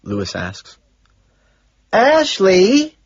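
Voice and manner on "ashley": high, loud call; full-volume yell; shouting. A narrator's reading. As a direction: shout the name to get attention. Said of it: "Evelyn shouts".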